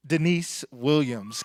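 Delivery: usual speaking voice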